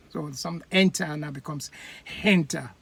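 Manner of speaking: "enter" plain, then "henter" with an H put in front; Yoruba added H. Shown as a demonstration. Yoruba speaker putting an H on enter. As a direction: add an H to "henter". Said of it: "becomes henter".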